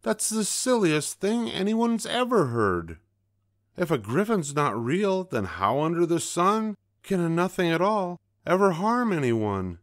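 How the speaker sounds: high, loud furious scolding; explosive rage. A narrator's reading. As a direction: keep raging at the owl. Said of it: "she exploded".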